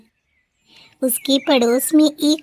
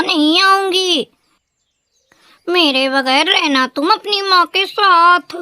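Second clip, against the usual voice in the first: put-on higher, loud, scornful bahu shouting down the old woman; rude daughter-in-law; 4 semitones higher, 3 dB louder than usual. A narrator's reading. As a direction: tell the old woman off with contempt. Wife threatens to leave. (घमंडी बहू की ऊँची, तीखी डाँट)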